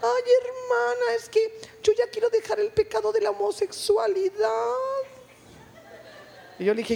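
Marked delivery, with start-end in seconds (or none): 0.0-4.7 effeminate little voice. Retold or imitated. Preacher imitating a big man confessing to her, high little effeminate voice. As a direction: whine it out high and sing-song, imitating him. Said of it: "con una vocecita".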